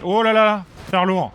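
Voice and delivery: cri soudain, plus haut et fort; lâche-le comme une surprise en jeu; exclamation surprise. Real, not acted. (exclamation excitée)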